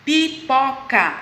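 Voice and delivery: "Pipoca" in three separate syllables. Shown as a demonstration. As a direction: break "Pipoca" into its three beats. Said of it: "é uma palavra trissílaba". ("Pipoca" dividido em três sílabas)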